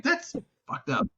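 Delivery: mock outrage at the phone; disgusted disbelief. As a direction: say it in shocked disbelief. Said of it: "I was like".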